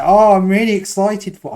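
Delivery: a botched, eager Brummy impression; failed Brummy accent. Mimicking Chris in a half-hearted Brummy accent. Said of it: "I can't do a Brummy accent".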